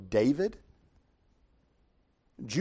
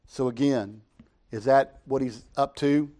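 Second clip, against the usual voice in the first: ordinary talk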